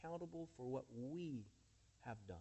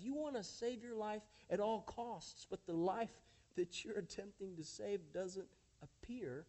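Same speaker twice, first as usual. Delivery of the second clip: normal talking voice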